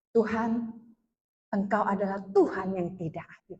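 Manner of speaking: low, soft reproach to God; subdued retold complaint. Woman telling God He is unfair, low and subdued. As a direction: murmur the accusation low and restrained. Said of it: "saya bilang gini".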